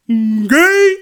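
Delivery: mimicking the comic movie villain, loud and slow; Hangover movie character impression. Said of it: "the Chinese dude, where he's like".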